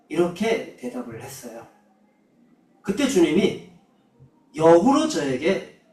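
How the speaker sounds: normal talking voice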